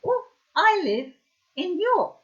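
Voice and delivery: high, loud squeaky monkey voice for "I live in York"; high squeaky monkey saying "I live in York"; high puppet voice. Pretend. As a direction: make the toy monkey announce it in a squeak. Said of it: "wants to go".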